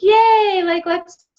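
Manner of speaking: high, loud put-on cheer, a hammed-up yay; mock cheerful. Speaker mimicking the upbeat front she refuses, mock cheerful. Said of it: "instead of be like".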